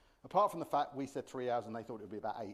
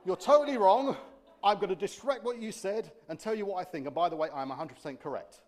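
loud blunt rant at first, higher; blunt translation; 4 semitones higher, 7 dB louder than usual. Pretend. The real meaning, said loud and blunt. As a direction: spit out the brutal truth.

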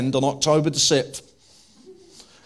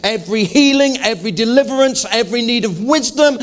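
preaching at full force; 7 semitones higher, 4 dB louder than usual. Real, not acted.

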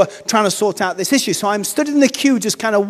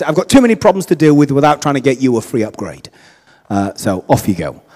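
much lower, quick, dismissive clerk; gruff refusal; 8 semitones lower than usual. Retold or imitated. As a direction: brush him off like a swamped official. Stressed clerk refuses the upgrade.

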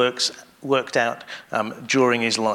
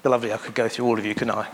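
ordinary talk

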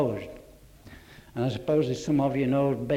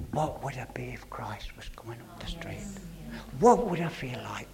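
breathy whisper to himself; whispered thought; 7 dB quieter than usual. Retold or imitated. Old man recalling his own conscience, whispered. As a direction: hush it like a private conscience.

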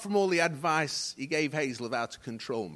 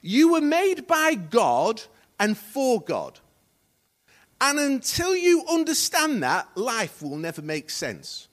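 loud, slow proclamation, easing at the end; emphatic quotation; 5 semitones higher, 9 dB louder, slower than usual. Real, not acted. Preacher quoting a writer to congregation, emphatic proclamation. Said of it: "Rick Warren said this".

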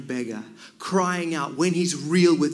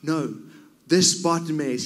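ordinary talk, nothing marked

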